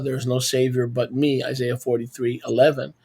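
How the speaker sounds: normal talking voice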